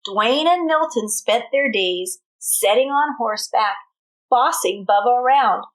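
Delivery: lively read-aloud with a country twang; storybook reading. A narrator's reading. Teacher reading a cowboy tale to her class, lively twang.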